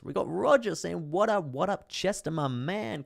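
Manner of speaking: high, loud, stretched-out hype voice; over-the-top excited viewer shout-out; hyped shout-out. Pretend. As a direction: draw out the greeting in a high, playful holler. Hyped shout-out reading viewer greeting.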